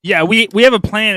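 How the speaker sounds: loud, high, pushing the plan; loud insistent. Acted. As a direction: insist on it loudly. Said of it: "I'm like".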